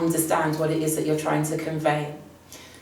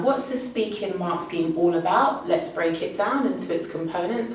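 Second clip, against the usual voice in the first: ordinary talk, nothing marked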